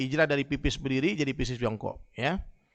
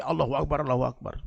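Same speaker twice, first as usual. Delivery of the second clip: ordinary delivery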